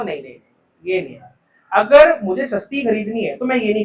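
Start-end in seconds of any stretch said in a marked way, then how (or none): 0.0-1.3 dismissive no. Pretend. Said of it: "तो मैं कहूँगा".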